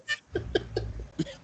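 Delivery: short burst of laughter; brief laugh. Real, not acted. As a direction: laugh breaking into the line. Laughs mid sentence.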